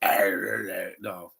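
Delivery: low mock villain growl; mocking a too-deep villain voice; deep Joker voice. Shown as a demonstration. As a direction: drop it low, like the wrong villain. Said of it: "not supposed to have a deep voice like that".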